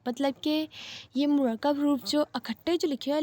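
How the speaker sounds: ordinary delivery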